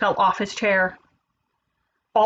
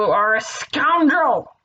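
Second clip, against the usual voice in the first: slow, slightly raised reproach; mildly angry scolding; 4 semitones higher, 4 dB louder, much slower than usual. Acted. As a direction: draw out the accusation. Pig angrily scolds the wolf.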